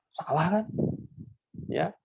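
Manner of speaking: ordinary talk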